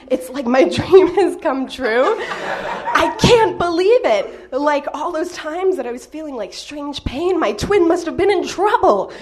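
bubbling over with disbelieving joy; gushing, slowing down to savor it; giddy excitement. Retold or imitated. Giddy over finding a twin.

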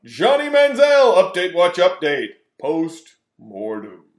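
loud announcer call dying down slowly; shouted title fading out. Acted. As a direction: start big then trail off. Echoing segment title, fading out.

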